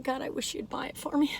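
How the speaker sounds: soft, private wishing aside; secret wish. Retold or imitated. Reviewer secretly wishing a companion would buy Nutella, hushed. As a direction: keep it under your breath, softly. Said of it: "I was, like, secretly going".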